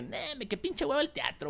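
high-pitched scoffing at a boring idea; mock dismissive. Actor quoting his old self on theatre, mock dismissive. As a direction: imitate a lazy, scornful refusal.

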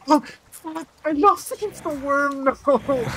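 high, drawn-out hammed-up cry; mock wail of defeat. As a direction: wail in exaggerated despair over a game loss. Wailing over losing in game.

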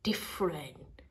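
whispered, unvoiced; a secret whisper. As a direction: breathe the word out in a hush. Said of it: "he said".

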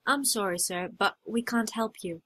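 usual speaking voice

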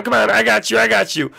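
ordinary delivery

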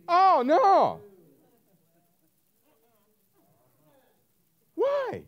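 high, loud oh no; mock alarm. Preacher to congregation, loud comic alarm. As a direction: gasp in comic dismay at the vow. Reacting to people's rash vow.

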